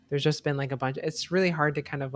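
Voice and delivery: normal talking voice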